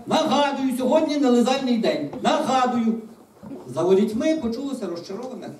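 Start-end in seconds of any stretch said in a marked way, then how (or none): 0.1-2.9 high, loud singsong announcement; calling out. A narrator's reading. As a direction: call it out over a crowd beyond the gate. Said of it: "за воріт вона гукнула".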